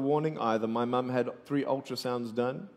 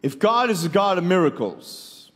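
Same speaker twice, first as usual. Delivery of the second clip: a louder, higher outcry; loud challenge; 5 semitones higher, 7 dB louder than usual. Acted. Speaker demands where God is, loud and higher. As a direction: call out the question to heaven.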